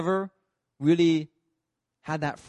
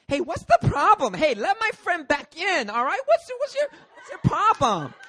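put-on high, loud drunken challenge; stretched, squealing drunk indignation; drunk friend; 5 dB louder than usual. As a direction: imitate a belligerent drunk friend. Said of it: "who was really drunk".

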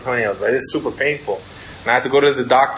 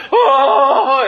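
long, high, loud mock howl; acted pain howl; 11 semitones higher, 5 dB louder than usual. Patient re-enacting ingrown toenail surgery, loud hammed-up howl. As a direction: howl as if your toe is being poked. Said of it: "It was so painful".

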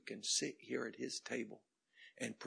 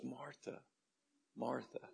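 very soft, kind calling; quiet, affectionate reproof; tender; 8 dB quieter than usual. Retold or imitated. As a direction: gently soothe her with her name. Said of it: "He says it so tenderly".

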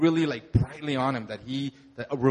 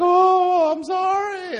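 much higher, loud, overwhelmed "Oh, I'm sorry"; overcome apology; 7 dB louder, much slower than usual. Retold or imitated. Pastor imitates the collapsed man apologizing, high and loud. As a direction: cry out the apology high and raw. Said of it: "then he's like".

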